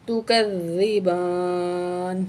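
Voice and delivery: slow, chanted tajweed recitation; melodic Quran recitation. Shown as a demonstration. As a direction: intone the refrain with long held notes.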